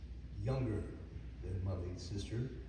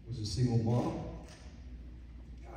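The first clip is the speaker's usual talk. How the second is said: ordinary talk, nothing marked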